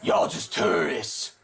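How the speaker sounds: low, slow, rasped gruff growl; Batman voice. Acted. Gamer doing his Batman voice at prison inmates, low slow rasp. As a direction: growl it like a brooding vigilante. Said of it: "that was my Batman voice".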